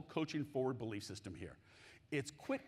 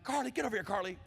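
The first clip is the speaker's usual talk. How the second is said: calling out; 5 semitones higher, faster than usual. Retold or imitated. Dad calling daughter at halftime.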